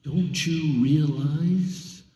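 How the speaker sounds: slow, measured menace rising high; threatening. A narrator's reading. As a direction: lean on each word, power in your hand. Pilate threatens silent Jesus.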